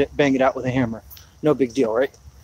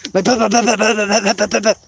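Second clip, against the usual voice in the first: imitated mower clatter; 5 semitones higher than usual. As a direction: sound out the blades knocking as he mows. Imitating broken mower blades again.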